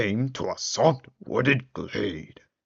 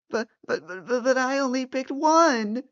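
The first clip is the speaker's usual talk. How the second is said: high, stammering panic; frightened stammer. Acted. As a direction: stutter high in fear. Old man panics.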